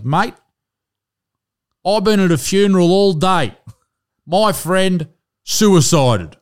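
imitating a drunk punter begging to speak; mock drunk heckler. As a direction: play the grieving drunk asking for the mic. Retold heckler asking for mic.

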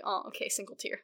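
soft, deflated murmur; mock sad sigh. Moliere quietly giving up his elopement, softly mock sad. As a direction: say it under your breath, mock heartbroken. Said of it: "So Moliere's just like".

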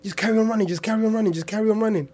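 high, urgent repeated push; urgent urging. Retold or imitated. Friends urging the wounded speaker to keep running, high urgency. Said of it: "they were like".

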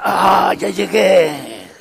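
mock weary groan on arriving at work. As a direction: drag it out with a heavy groan. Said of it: "vuelve a pujar".

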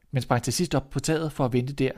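usual speaking voice

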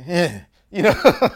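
laughing it out; chuckling through a word. Real, not acted. Laughing at his own reaction.

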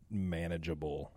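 usual speaking voice

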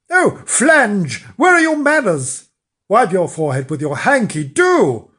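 loud, swooping wifely reproach; exasperated nagging; scolding. A narrator's reading. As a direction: tell him off loudly, high on the exclamations. Wife scolds husband about manners.

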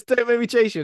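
high, loud hammed-up cop yell; mock angry shout. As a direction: bark it like a fed-up detective. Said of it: "he says".

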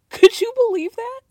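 high, loud indignant squeal; outraged disbelief. Real, not acted. Viewer indignant at what the wife said. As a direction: shriek the disbelief.

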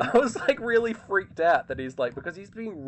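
high, loud, worked-up retelling; excited. Real, not acted. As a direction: pitch up, loud, in disbelief. Describes being freaked out.

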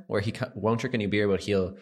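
ordinary talk

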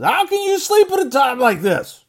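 high, loud, disbelieving captain's cry; exasperated sea captain. Acted. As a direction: shout it over the wind at the sleeper. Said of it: "And the captain says".

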